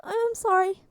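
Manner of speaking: high, loud tearful wail of sorry; crying apology. Acted. Crying boy apologizes to Tony, high tearful wail. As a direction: blubber it like a drugged kid. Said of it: "started crying".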